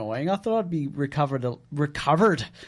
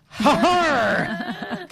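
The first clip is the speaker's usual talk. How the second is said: pirate laugh; 10 semitones higher, 3 dB louder than usual. Pretend.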